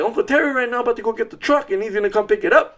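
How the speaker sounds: put-on high, fast, thrilled boy voice; boy on the phone telling dad the plan; excited kid. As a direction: rush it out like an overjoyed kid. Happy son on the phone.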